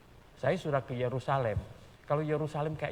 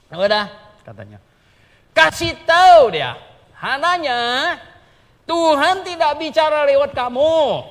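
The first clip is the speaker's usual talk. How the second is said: loud, high booming voice of God; commanding shout of judgment; divine proclamation; 11 semitones higher, 16 dB louder than usual. Acted. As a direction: thunder the verdict from above. God's word to Jeremiah proclaimed.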